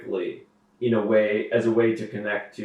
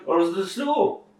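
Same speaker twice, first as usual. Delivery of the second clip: imitating Alan Watts; 6 semitones higher than usual.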